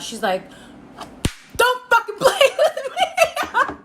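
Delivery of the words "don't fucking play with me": much higher and slow, breaking into laughter; imitating angry mom. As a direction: mimic mom's scolding while cracking up. Mom confronting her over stolen shoes.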